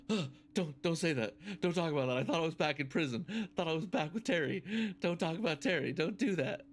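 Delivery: comic imitation of flustered begging; panicked pleading. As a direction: act out a rattled man begging them to stop. Retold skit, traumatised grandfather pleading.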